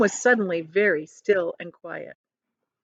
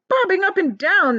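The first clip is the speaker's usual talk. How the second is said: high, alarmed cry; 12 semitones higher, 5 dB louder than usual. Acted. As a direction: shout back in shock. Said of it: "they cried".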